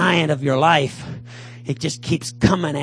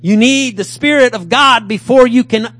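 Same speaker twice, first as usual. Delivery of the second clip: preaching at full force; 6 semitones higher, 8 dB louder than usual. Acted.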